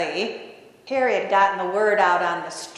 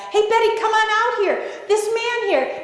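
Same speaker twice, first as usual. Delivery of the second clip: high, hurried call to the back; excited holler through a doorway; 10 semitones higher than usual. Retold or imitated. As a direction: summon someone from the next room. Calls wife out from back.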